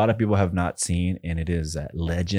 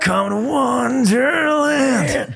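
high, slow, stretched rock-singer ad voice; much slower than usual. Retold or imitated. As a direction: croon it like a cheesy radio jingle. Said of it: "sounded like [name]".

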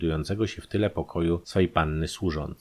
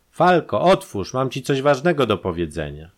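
high, loud call through a door; urgent calling out; 6 semitones higher, 5 dB louder than usual. A narrator's reading. Countess beats on brother's bedroom door.

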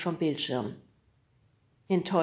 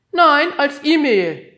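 slow, loud and higher teasing joke; joking; 9 semitones higher, 14 dB louder, slower than usual. A narrator's reading. Kriegelstein joking with the boys, slow and loud. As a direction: deliver the silly joke with relish. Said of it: "lachte über seinen eigenen Scherz".

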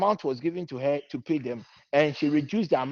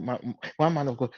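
normal talking voice

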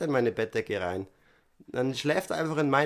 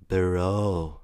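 imitating roommate; 6 semitones lower than usual. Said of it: "Und er meinte so".

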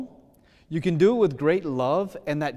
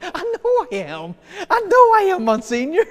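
laughing; 7 dB louder than usual. Real, not acted. Retelling being called arrogant.

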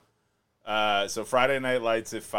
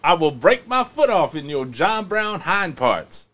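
loud, higher coach threat; Denzel coach voice; 7 semitones higher, 10 dB louder than usual. Retold or imitated. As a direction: bark it like an angry football coach. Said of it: "Denzel as a coach is believable".

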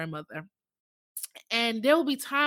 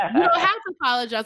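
laughing grandma line; 7 semitones higher, much faster than usual. Retold or imitated.

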